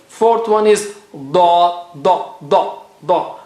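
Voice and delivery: da said slowly, with the tongue tip turned onto the gums; touch the gums and say da; Arabic da. Shown as a demonstration. Teacher showing students where da is made, slow and careful. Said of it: "turn your tongue a little bit".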